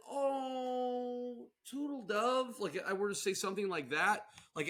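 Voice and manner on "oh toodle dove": high, drawn-out cutesy coo; mock-sweet greeting. Joker tries a pet name on a partner, mock-sweet. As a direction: lay on a sugary endearment.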